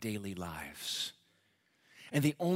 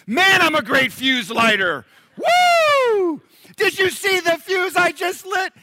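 much higher, much louder gloating shout; bragging fuse lighter; 15 dB louder, slower than usual. Retold or imitated. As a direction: crow like a show-off who lit a fuse. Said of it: "runs around and goes".

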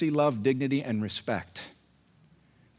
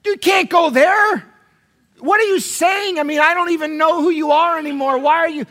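outraged relative; 13 semitones higher, 9 dB louder than usual. Acted. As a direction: shout in hammed-up disbelief at a loved one. Family confronting a churchgoing relative.

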